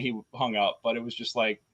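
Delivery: normal talking voice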